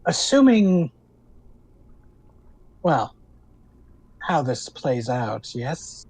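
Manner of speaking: slow, knowing hag voice; green hag voice. Acted. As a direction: lean in and hint slyly. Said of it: "She goes".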